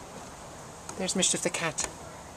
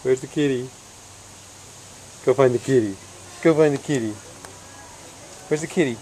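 ordinary talk, nothing marked

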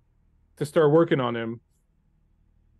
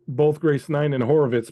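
ordinary delivery

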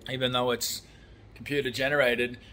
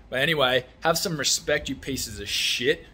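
usual speaking voice

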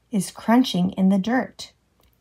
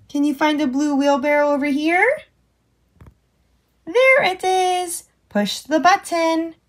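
ordinary delivery

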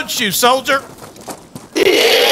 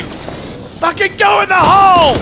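shouting; 3 semitones higher than usual. Real, not acted. Yelling during combat.

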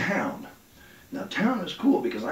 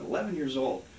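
usual speaking voice

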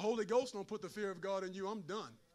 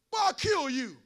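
threatening daddy; 5 semitones higher, 8 dB louder than usual. Retold or imitated. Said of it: "That ain't God telling you he gonna kill you".

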